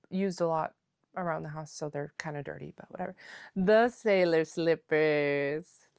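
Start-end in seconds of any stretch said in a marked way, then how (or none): 3.6-5.9 title announcement. Real, not acted.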